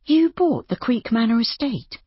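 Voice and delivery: high-pitched incredulous question; disbelief. A narrator's reading. Judy's guest hearing who the new owner is, incredulous. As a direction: lift YOU high in disbelief. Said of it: "gazed at Rob in disbelief".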